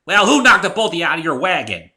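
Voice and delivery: loud, high and indignant; fighting back at an old friend; heated argument. Acted. Heated quarrel between friends.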